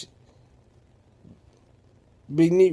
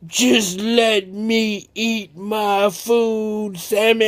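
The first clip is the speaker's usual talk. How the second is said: slow, higher brush-off; slow dismissive; 4 semitones higher, slower than usual. Acted. Big Neat brushes off Sammy, slow and dismissive. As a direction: drag it out, too busy eating. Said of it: "she replied".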